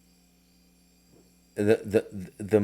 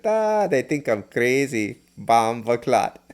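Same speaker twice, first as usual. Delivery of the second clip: slow, higher Jamaican patois imitation; a friend's Jamaican patois, slow and lifted; thick Jamaican patois; 4 semitones higher, 3 dB louder, slower than usual. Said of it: "In this thick patois Jamaican accent".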